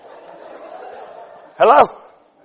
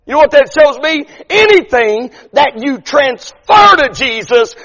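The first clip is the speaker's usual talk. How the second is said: higher, louder preaching, slowing on the key line; full-force preaching; 9 semitones higher, 6 dB louder than usual. Acted. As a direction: drive the point home to the congregation.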